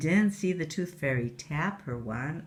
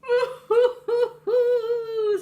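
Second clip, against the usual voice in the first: high sobbing wail; 7 dB louder than usual. Acted. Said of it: "said the Tooth Fairy, crying".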